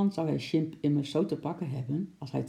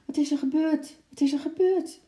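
very high-pitched, urgent cry; alarmed calling out; 12 semitones higher, 4 dB louder than usual. A narrator's reading. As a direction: call out high like startled passengers wanting news. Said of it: "riepen de mensen in de andere coupés". (hoge, opgeschrikte roep)

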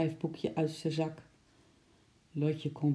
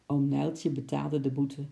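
ordinary delivery

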